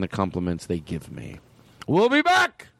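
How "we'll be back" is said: big, high announcer call; radio host voice. Pretend. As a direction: shout it like a show host. Mock radio break announcement.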